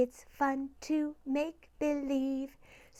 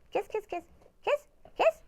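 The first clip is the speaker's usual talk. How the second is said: high coaxing; 5 semitones higher, much faster than usual. Acted. Coaxing pet for kiss.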